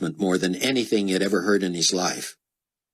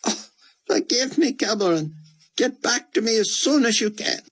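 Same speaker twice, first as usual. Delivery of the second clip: put-on high, anxious old scholar voice; pleading old man. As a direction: beg as a frightened old man. Scholar apologizing.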